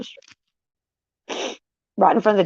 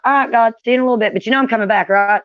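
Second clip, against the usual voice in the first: fast, higher, louder breezy farewell; chirpy rush of words; quick bright send-off to God, louder; cheery fast goodbye; 5 semitones higher, 5 dB louder, faster than usual. Retold or imitated. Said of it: "And I'm like".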